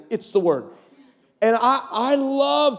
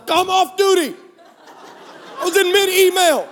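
high, laughing mock outrage; laughing protest; 9 semitones higher than usual. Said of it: "and like".

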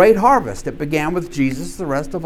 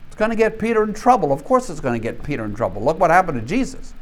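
ordinary talk

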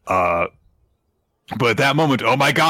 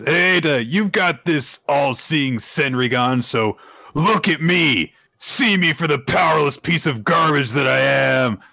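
raised, pleading shout as the broken hero; pained Kawaki; 5 semitones higher than usual. Retold or imitated. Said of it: "So Kawaki says".

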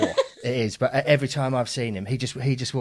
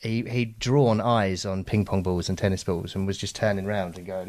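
ordinary delivery

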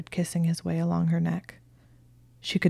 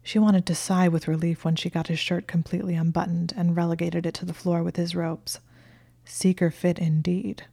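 usual speaking voice

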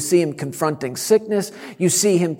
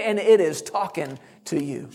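usual speaking voice

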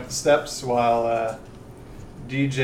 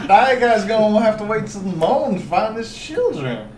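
higher-pitched southern magistrate; southern accent; 6 semitones higher, 4 dB louder than usual. Acted. Magistrate telling adventurers to wait till morning, southern accent. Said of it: "I'd have a southern accent all of a sudden".

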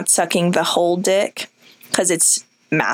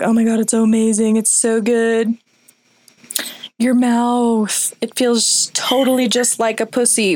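lightly voiced ecstatic angel, pitched up; mock freaking-out angel; 4 semitones higher than usual. Host voicing the angel freaking out, mock ecstatic. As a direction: gush in mock ecstasy, a little raised. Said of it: "our angel's freaking out 'cause she's like".

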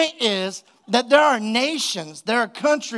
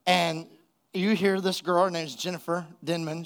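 normal talking voice

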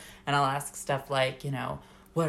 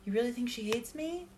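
imitating a friend; 9 semitones higher, 5 dB quieter than usual. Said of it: "you were like".

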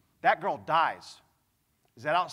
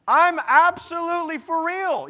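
emphatic insistence; 11 semitones higher, 8 dB louder than usual. Real, not acted. Insists after congregation laughs.

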